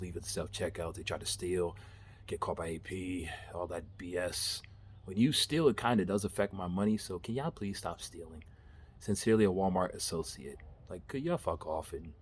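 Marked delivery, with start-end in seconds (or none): none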